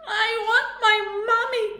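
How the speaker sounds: high, loud bawling; terrified robot bawling for his mother. A narrator's reading. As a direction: bawl for mommy. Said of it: "Clang bawled".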